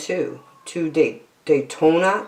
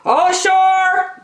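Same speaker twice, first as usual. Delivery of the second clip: high, loud call of sailors; men shouting; 13 semitones higher, 3 dB louder than usual. A narrator's reading. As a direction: announce landing to everyone. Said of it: "the men shouted".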